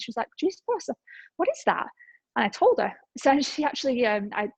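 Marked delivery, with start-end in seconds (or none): 0.4-1.9 much higher, surprised question; curious stranger. Retold or imitated. Beach woman hearing about Juice Plus, surprised and high. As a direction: sound intrigued and a bit amazed.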